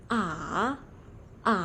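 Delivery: "A" dipping down then rising; "A" with a fall-rise dip; third tone. Shown as a demonstration. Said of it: "turun terus naik".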